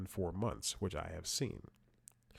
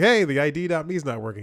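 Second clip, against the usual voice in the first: higher, louder, quicker; loud complaint; 6 semitones higher, 10 dB louder, faster than usual. Retold or imitated. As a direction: raise it, a bit fast. Retold call, raised voice.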